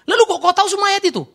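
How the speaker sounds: high, loud, rapid-fire incredulous question; acted astonishment. Preacher questions a headstone seller who knows every verse, astonished. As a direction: fire the question out fast, voice shooting up.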